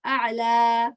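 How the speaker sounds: "a'la" with the last a held long; two-count sound. Shown as a demonstration. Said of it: "two count sound".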